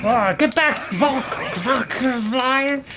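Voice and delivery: high shout at foes. Real, not acted. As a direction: call out the attack. Casting a shout at enemies.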